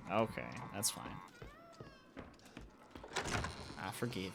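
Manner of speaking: soft, resigned mumble; quiet resignation. Real, not acted. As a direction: let it go under your breath. Resigned forgiveness, much softer.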